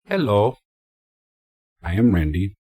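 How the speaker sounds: lightly put-on odd greeting voice; weird voice. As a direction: introduce yourself in a slightly silly voice. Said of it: "I use that weird voice".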